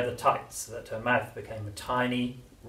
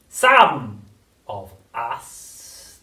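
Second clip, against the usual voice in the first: throaty witch whisper; 15 dB louder, much slower than usual. A narrator's reading. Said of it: "whispering in a throaty sort of voice".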